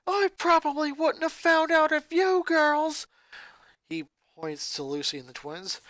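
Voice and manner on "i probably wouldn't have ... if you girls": put-on high, loud furious boy shouting at family; a boy exploding at his mother; angry boy ranting. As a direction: snap back at Mom, furious. Lincoln accuses sisters.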